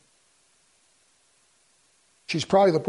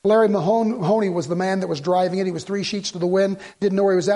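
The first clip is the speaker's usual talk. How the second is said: ordinary talk, nothing marked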